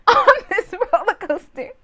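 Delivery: high, tearful-sounding comic wail through laughter; helpless wail. Retold or imitated. Helpless laughing lament on ride.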